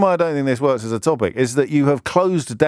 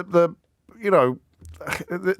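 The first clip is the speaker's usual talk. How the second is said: ordinary talk, nothing marked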